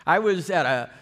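ordinary talk, nothing marked

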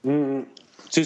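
talking through a mouthful of fruit; eating while talking. Real, not acted. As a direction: mouth full of mandarin. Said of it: "stavo mangiando un mandarino".

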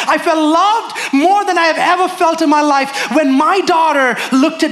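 high-pitched, ringing sermon climax; impassioned preaching. Real, not acted. Father telling congregation he felt loved, impassioned preaching. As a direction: build each phrase with fervent, high intensity.

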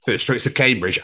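normal talking voice

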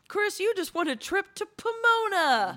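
high, loud mock host voice; game show announcer. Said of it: "if someone was like".

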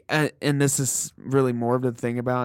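usual speaking voice

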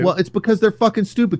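normal talking voice

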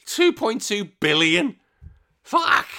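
high-pitched disbelief bursting into a swear; incredulous. Real, not acted. Host working out Mario Kart revenue, incredulous. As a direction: repeat the sum in astonishment, then curse. Astonished by his revenue sum.

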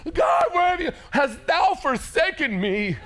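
high loud wail at God; mock anguished cry. Pastor mimicking a believer crying out at God, high and loud. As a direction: cry out, hammed-up despair. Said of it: "something doesn't work out".

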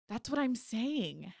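talking through chocolate; words round a bite of candy; mouth full. Acted. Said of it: "her mouth full of chocolate".

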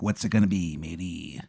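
low, hushed pirate, trailing into a growled arr; pirate tomato. Acted. Pirate tomato on his vine demands a choice, low and hushed. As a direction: press for an answer as a soft pirate. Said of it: "he's a pirate".

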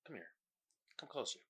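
soft, secretive summons; confidential beckoning. Pretend.

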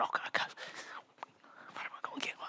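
breathless unvoiced whisper; awed whisper. Kid in the store aisle with a hundred bucks, whispering in awe. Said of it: "they're gonna be on the aisle going".

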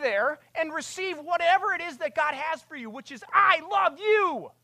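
high, loud impassioned preaching; preaching at full force. Acted. Preacher urges the congregation to stop trying, high and loud. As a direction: plead with them at the top of the voice.

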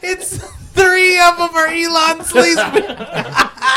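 high laughing yell; laughing shout. Real, not acted. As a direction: shout it while cracking up.

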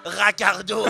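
high, loud delighted cry; delighted NPC. Acted. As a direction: call the name out with joy. NPC repeats name delightedly.

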